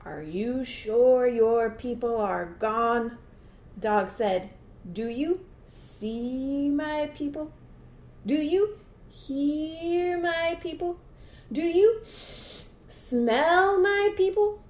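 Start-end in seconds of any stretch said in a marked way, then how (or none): 0.0-3.2 slow, stretched-out alligator question; lightly voiced cautious gator; wary alligator. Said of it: "Alligator said". 4.9-14.6 high, slow questions, key verbs stretched; drawn-out leading questions with a sniff; coaxing dog. Acted. Dog coaxes alligator, builds suspense.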